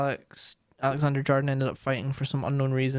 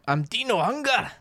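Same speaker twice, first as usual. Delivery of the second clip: angry ghost yelling; 10 semitones higher than usual. Acted. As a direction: shout like a trapped, starving ghost. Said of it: "Dunty's cry of".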